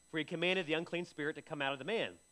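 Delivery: normal talking voice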